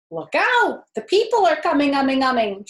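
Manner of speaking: loud, high honking warning shout; gander shouting. A narrator's reading. Gander warning the animals the people come, loud shout. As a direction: alarm the whole barnyard. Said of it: "shouted the gander".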